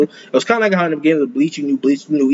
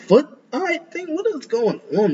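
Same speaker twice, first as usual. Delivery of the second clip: grossed out; 11 semitones higher, 4 dB quieter than usual. Real, not acted. Reacting to an ugly creature.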